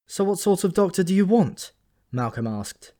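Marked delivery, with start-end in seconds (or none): 0.1-1.7 exasperated. Acted. Malcolm asks sarcastically.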